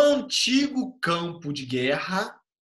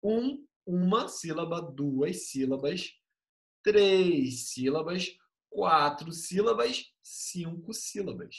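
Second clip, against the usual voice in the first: slow deliberate counting of syllables; slow classroom count; counting slowly; slower than usual. Shown as a demonstration. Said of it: "eu conto até só esse i".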